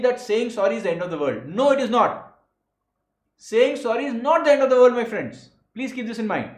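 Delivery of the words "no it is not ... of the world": loud, firm "not"; loud, insistent correction with weight on "not"; emphatic rebuttal. Real, not acted. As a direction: hit "not" hard, raised and forceful. Teacher firmly rebuts a belief.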